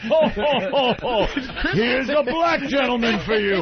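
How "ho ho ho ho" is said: jolly mock Santa ho ho ho; belly-laugh like Santa Claus; hammed-up Santa. Santa bit for Christmas joke.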